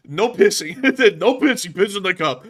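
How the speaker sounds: laughing hard through the words; laughing loudly. Real, not acted. Host cracking up at the no-pee-break joke, laughing loudly.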